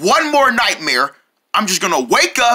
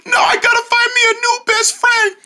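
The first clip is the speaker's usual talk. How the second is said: wailing cry. Acted. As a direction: howl it through comic tears. Wailing after friend's death.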